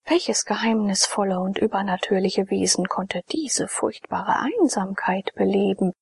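ordinary talk

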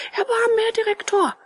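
very high puppet plea; pleading. A narrator's reading. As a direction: beg for mercy in a high voice. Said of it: "weinte und flehte". (hohes Flehen)

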